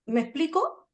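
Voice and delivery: ordinary talk, nothing marked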